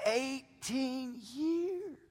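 EIGHTEEN... YEARS, stretched very slowly; stress on eighteen years. Shown as a demonstration. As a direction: answer with a long, drawn-out "Eighteen years". Answers own question for effect.

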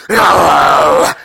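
booming monstrous hello; imitated woken-up mom. Mom woken in the night, hammed-up roar. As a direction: roar it like a mother rudely woken. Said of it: "when she wakes up, she's like".

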